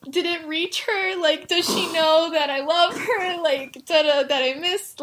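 half-laughing imitation of a tearful boy; mock crying. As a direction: retell it as an imitated wail. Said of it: "he starts crying".

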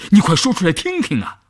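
high, eager demand; impatient urging. A narrator's reading. Commander pressing the woman to reveal her plan, impatient. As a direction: press for the answer, pitch jumping up.

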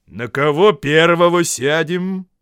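loud, high, slow question; troll asking. A narrator's reading. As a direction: call the question out slowly. Disguised voice prompts trolls.

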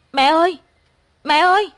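loud, high call for mother; acted distressed cry out to mother; screaming. A narrator's reading. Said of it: "Tôi la hét vang cả phòng".

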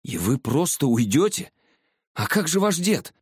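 called out after her, rising high; крикнул вслед. A narrator's reading. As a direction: call after someone leaving. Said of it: "опомнился и крикнул". (окликнул вдогонку)

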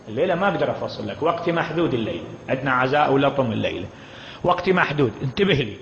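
ordinary delivery

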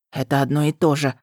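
ordinary delivery, nothing marked

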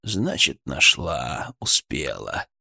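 low, soft satisfied drawl. Acted. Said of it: "удовлетворённо кивнул сержант". (удовлетворённо, негромко и низко)

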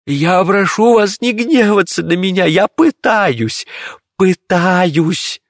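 very high, panicked begging; terrified mage's high-pitched plea; frantic pleading. A narrator's reading. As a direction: plead desperately in a high, wailing voice. Frightened mage begs angry emperor.

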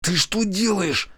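high, drawn-out strained hiss; alarmed hiss. A narrator's reading. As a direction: hiss it slowly, pitched well up. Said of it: "зашипел Колян". (зашипеть высоким голосом)

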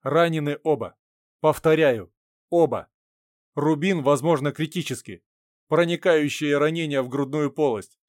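loud urgent radio report; over walkie talkie. Acted.

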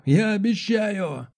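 high, stretched-out cry; yelling. A narrator's reading. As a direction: call a desperate vow after someone. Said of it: "заорал я". (высокий отчаянный крик)

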